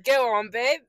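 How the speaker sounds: Chris Hemsworth. Retold or imitated. Repeating the interview joke to co-host, mock Australian accent. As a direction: throw out a hammed-up Australian come-on. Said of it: "an impression of an Australian accent".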